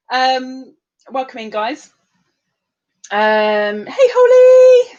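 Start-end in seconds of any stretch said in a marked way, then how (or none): 3.8-5.0 voicing Jennifer. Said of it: "says Jennifer".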